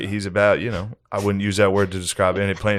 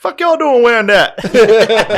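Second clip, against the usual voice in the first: mock angry reenactor; 13 semitones higher, 7 dB louder than usual. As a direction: ham up a scolding in character. Imagined reenactor scolding, high.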